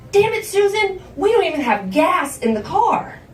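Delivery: high, loud angry outburst; acted-out furious mother; exasperated yelling. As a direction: scold loudly at a high pitch. Mother furious about empty tank.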